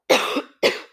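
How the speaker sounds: single real cough; a sharp cough into the mic. Real, not acted. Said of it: "Sorry, ich muss husten".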